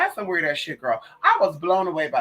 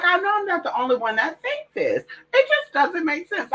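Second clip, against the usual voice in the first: high exasperated quote; 12 semitones higher than usual. Retold or imitated. Quoting own exasperated thought.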